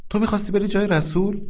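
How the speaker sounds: high, stunned question; the wife can't believe it; alarmed disbelief. Acted. Wife alarmed at her husband's plan, high disbelief.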